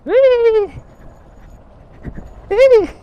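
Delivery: high, loud squeal of "whee"; gleeful whee. Real, not acted. Friends free-wheel racing down the farm hill, gleeful squeal. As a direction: whoop it high as you coast downhill. Cheering while racing downhill.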